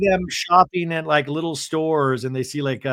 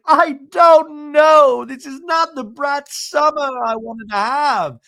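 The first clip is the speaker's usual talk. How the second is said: hammed-up crying, high drawn-out wail; Bronwyn; 10 semitones higher, 7 dB louder, slower than usual. Crying to the producer, high mock wail. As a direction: imitate a loud, slow, whiny sob. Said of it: "And Bronwyn's crying".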